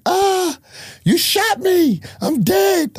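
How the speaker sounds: high-pitched, hammed-up cry of a man who thinks he's shot; mock dying wail. Friend pistol-whipped by the narrator, imitated in a high mock wail. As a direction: squeal it like you're sure you're dead.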